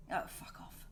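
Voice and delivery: whispered brush-off; whispered aside. Real, not acted. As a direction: whisper it under your breath. Aside to the cat.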